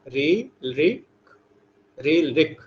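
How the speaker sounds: drawn out syllable by syllable; slow, careful chanting of री, रिक; slow recitation. Shown as a demonstration. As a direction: recite each sound slowly for the class. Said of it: "इसको कैसे बोलेंगे हम लोग?".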